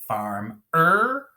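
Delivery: "farm-er" split, with the ER drawn out; "farm-er" in two slow separate beats; added syllable. Shown as a demonstration. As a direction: hold back, then push the ER of "farm-er". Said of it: "add on that last syllable".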